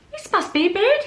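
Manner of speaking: light up at finding a bed; delighted discovery. Acted. Toy bear discovering a bed, delighted. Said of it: "he said".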